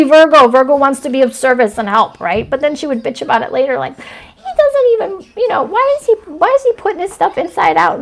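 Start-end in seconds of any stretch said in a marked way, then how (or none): 4.0-8.0 complaining grandma. Retold or imitated. Grandma griping about the doctor upstairs, high mock complaint. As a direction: whine like grandma griping about the tenant's laundry.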